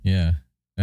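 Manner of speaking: normal talking voice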